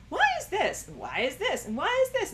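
put-on high child voice asking why; childlike why. Speaker mimics a child's endless whys. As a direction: play a curious little kid asking.

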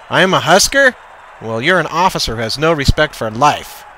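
high, loud indignation; indignant reply. A narrator's reading. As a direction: throw the insult back. Coach answers the insult.

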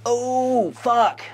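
sudden stunned realisation; shocked curse. Real, not acted. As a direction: blurt it out in shock. Shocked reaction to reveal.

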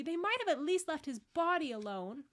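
high, loud hurt protest; upset child complaining; aggrieved. A narrator's reading. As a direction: protest tearfully at the cruelty. Lucy grieving the missing body.

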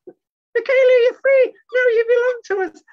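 very high, loud monkey announcement; Mickey's joyful squeal. Acted. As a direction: squeal the good news like a puppet. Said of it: "and Mickey says".

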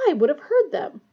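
bristling. A narrator's reading. Matron denying the students left their beds, bristling. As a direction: bristle with offended emphasis.

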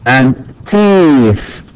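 teeth drawn out slowly; teacher modelling teeth; slow model word. Shown as a demonstration. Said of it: "Tooth،".

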